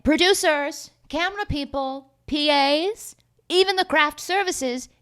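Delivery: high, calling-out announcement; grand high-pitched roll call; mock summoning. Retold summons to the whole crew.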